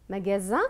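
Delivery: "Magasin" with buzzing z before the last vowel; Z sound. Shown as a demonstration. Teacher repeats the choice to students, "Magasin" with z. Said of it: "qu'est-ce qu'on dit ici?".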